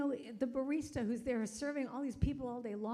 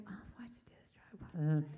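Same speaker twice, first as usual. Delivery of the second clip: slower whispered self-reproach; regretful whisper; 6 semitones lower than usual. Retold or imitated. Regretting paying for the stranger's coffee, whispered. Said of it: "I'm just like".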